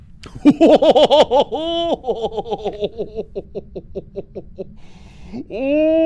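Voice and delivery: high, loud wavering "hoooo"; long pulsing howl that fades away. Acted. Game master makes drawn-out eerie howl.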